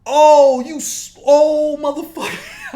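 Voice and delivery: high, loud cursing explosion; drawn-out outraged yell; furious outburst. Retold or imitated. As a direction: erupt at the old man's nerve. Acting out his fury at man.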